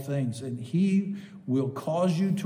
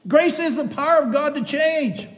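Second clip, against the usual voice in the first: elated; 11 semitones higher, 7 dB louder than usual. Real, not acted. Still elated after shouting.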